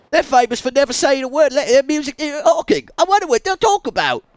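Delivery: high, loud punk shout; rockabilly gangster. Pretend. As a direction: bellow like a rockabilly hooligan. Voicing character.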